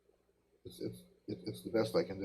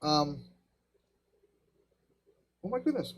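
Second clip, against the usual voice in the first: pained squeal through a brain freeze; wincing at a sudden cold headache; 4 semitones higher than usual. Real, not acted. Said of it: "I just got a brain freeze again".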